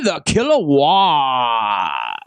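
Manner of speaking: deep, slow announcer drop on the name; dramatic announcer name. Acted. Announcing his cohost's ring name, low and slow.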